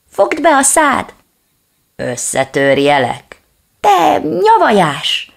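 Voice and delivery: high, loud furious shouting; angry yapping. Acted. Little dog hurls insults at the reflection, shouting. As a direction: spit out the insults at the enemy. Two dogs trade insults.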